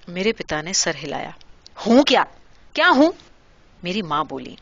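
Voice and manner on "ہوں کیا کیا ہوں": angry retort. A narrator's reading. Angry mother mocking husband's hum.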